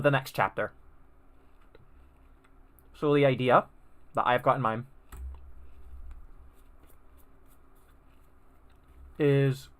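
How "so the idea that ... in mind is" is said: talking through a mouthful of cereal; chewing while talking. Real, not acted. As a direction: speak round a bite of frosted flakes. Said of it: "shouldn't be talking while eating".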